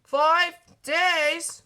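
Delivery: loud, high "Five days?"; aghast cry. Acted. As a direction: cry it in dismay. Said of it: "McQueen had cried".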